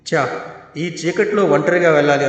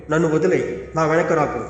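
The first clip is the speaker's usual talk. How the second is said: plain reading voice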